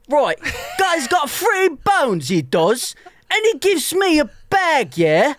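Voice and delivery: high mock-British dog voice. British dog talking about bones, high hammed-up British accent. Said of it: "we decided that he was a British dog".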